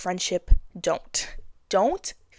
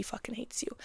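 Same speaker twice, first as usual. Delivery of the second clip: whisper; 2 semitones higher, 13 dB quieter than usual. Acted. Warning friend about Barbara.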